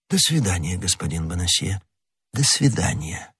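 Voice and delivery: soft, low-key courteous farewell; кардинал прощается. Acted. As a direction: quietly dismiss an inferior. Cardinal dismisses Bonacieux. (тихое, сдержанное прощание)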